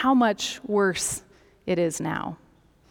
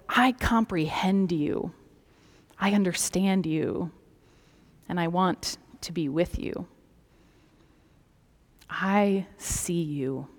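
heartfelt, softening to a hush; deep love; 3 semitones lower, 4 dB quieter than usual. Acted. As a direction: let each phrase sink gently into intimacy. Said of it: "an expression of deep love".

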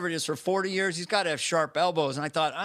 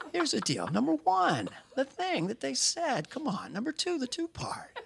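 put-on higher, much softer folksy old man; breathy town-hall grandpa, half whispered; Biden impression; 6 semitones higher, 3 dB quieter than usual. Host does Biden at a town hall, soft and folksy. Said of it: "I do him at the town hall".

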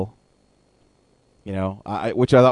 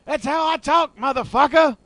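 Samuel L. Jackson yelling. Pretend. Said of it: "I can't stop yelling".

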